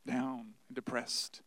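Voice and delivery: low, slow and dragging, a little dejected; mock-mopey; down and depressed. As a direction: let the words sag as if depressed. Walking around the house depressed.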